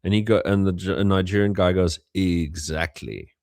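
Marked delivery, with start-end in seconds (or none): 2.1-3.3 slow, knowing "Exactly."; sly punchline. Retold or imitated. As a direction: drop it dry and slow, like a wink. Punchline of joke.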